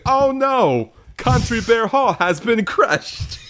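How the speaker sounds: high, slow mock-dismay villain line; sputtering foiled villain. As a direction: whine it like a beaten villain. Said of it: "a montage of him saying".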